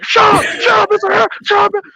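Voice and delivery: high, strained shouted silencing; imitated sergeant shouting. Drill sergeants silencing a protesting teen, mock shouting. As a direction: shout over the kid's protest.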